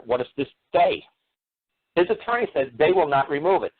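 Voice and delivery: usual speaking voice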